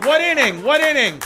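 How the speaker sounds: loud, high repeated demand; urgent shout. Real, not acted. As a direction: shout the question out to the crowd. Urgently asks crowd for score.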